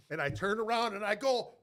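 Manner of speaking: ordinary talk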